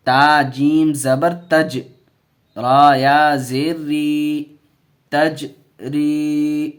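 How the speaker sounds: loud, slow syllable-by-syllable chanting of the letters; drawn-out classroom recitation; spelling-drill recitation. Shown as a demonstration. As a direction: call each letter and vowel out for the class to repeat. Teacher spells and recites drill words.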